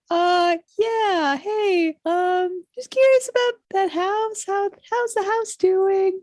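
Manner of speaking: high, put-on hesitant phone voice; sheepish long-distance caller. The Count's son calling collect about his old house, sheepish. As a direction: hem and haw in a high-pitched voice.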